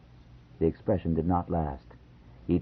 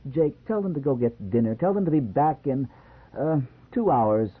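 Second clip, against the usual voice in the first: put-on pleading young wife; 7 semitones higher than usual. A narrator's reading. Joanne pleading, voiced higher.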